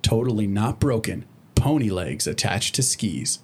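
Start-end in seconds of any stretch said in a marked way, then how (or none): none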